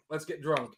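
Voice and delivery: put-on drunk said plainly with a short u; showing drunk. Shown as a demonstration. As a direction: say drunk the normal way. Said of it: "Say drunk".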